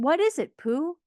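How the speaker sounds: high, excited query; eager question. A narrator's reading. Party guests crowding Pooh over his present, high and eager. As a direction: burst with curiosity.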